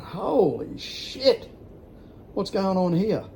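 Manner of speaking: reliving the moment he couldn't believe it; retold disbelief. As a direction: stretch it out in shocked disbelief. Retold shock at the abuse.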